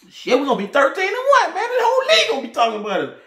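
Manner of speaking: high, fast laughing brag; giddy fan bragging about the season; laughing hype. Real, not acted. Laughing hype about going thirteen and one.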